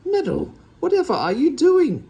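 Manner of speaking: high, loud shocked cry; horrified cry. A narrator's reading. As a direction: gasp it out in horror. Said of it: "in horror".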